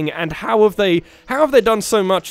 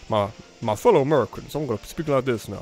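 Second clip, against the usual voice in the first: low American presidential address; half-hearted impression of an American president's speech; Barack Obama; 4 semitones lower than usual. Shown as a demonstration. Gamer trying a president addressing fellow Americans, American accent. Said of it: "I don't live in the Barack Obama".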